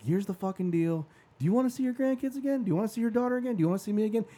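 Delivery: high, urgent retold plea, fairly quick; emotional pleading. Kid begging mom to get treatment, emotional high-pitched pleading. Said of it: "So I start crying".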